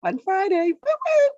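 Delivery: high, loud, gleeful whoop; excited cheer. Acted. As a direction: cheer it like a party host. Cheering the show's name.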